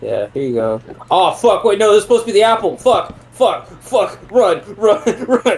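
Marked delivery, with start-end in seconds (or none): 1.1-5.6 frantic high panic; scrambling in a panic; panicked. Real, not acted. Enderman chasing in game.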